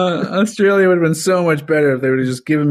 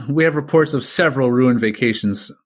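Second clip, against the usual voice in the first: usual speaking voice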